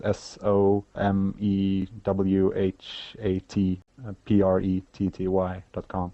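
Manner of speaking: slow, letter-by-letter spelling. Real, not acted. Guest spells the email address for podcast listeners, slowly letter by letter. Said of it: "Gathering at Somewhat Pretty".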